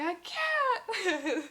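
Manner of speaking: high, loud laughing protest; laughing cry. Retold or imitated. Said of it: "I was like".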